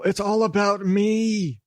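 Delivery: high, drawn-out gloating singsong; hammed-up diva boast; mock egotism. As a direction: stretch it out high and self-important.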